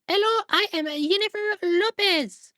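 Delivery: very high, slow, put-on hand puppet voice; mock accented puppet voice. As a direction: squeak it out like a cartoon hand puppet. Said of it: "makes it talk and gives it an accent".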